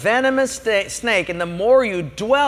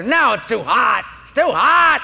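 irate customer; 3 semitones higher, 3 dB louder than usual. Retold or imitated. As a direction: whine it like a fussy diner. Irate customer complains again.

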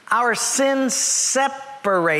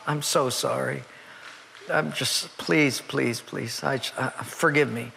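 pleading sorry; 5 semitones lower, 6 dB quieter than usual. Retold or imitated. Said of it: "And he says".

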